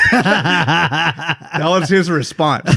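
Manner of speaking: cracking up at the punchline; laughing. Real, not acted. Cracking up at own story.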